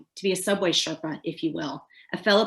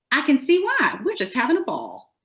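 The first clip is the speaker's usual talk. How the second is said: approving tourist response; 6 semitones higher than usual. Acted. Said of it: "one of two different responses".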